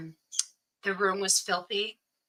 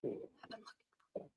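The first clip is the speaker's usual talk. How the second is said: a soft, breathy whisper into the ear; whisper in her ear; 3 semitones higher, 22 dB quieter, faster than usual. Retold or imitated. Leaning into her blind sister's ear, whispering. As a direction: lean close and breathe the words. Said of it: "I leaned in her ear".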